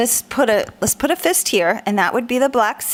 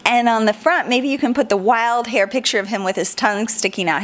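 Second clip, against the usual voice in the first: ordinary talk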